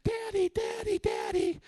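put-on high child voice calling out; imitating a little girl calling her father; child calling daddy. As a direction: call for daddy like a toddler.

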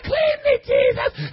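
high, crying mock plea; hammed-up sinner begging to be cleaned; whiny sinner. Preacher mocking a sinner pleading with Jesus, high whiny cry.

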